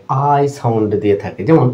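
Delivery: ordinary talk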